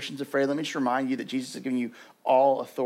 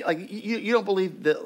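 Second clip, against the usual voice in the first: ordinary talk, nothing marked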